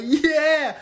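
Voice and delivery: loud, high, drawn-out yeah; boastful yell. Retold or imitated. Hush Puppy answers his hyped fans, crowing. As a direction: crow it proudly, high and loud.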